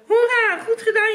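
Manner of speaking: high, loud jubilant cheer. A narrator's reading. Hans to his sister after victory, cheering. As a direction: cheer her on loudly. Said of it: "juichte Hans".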